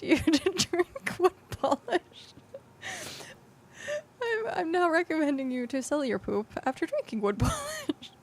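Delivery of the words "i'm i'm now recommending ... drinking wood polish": giggling through the words; laughing. Real, not acted. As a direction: laugh while trying to talk. Laughing at own advice.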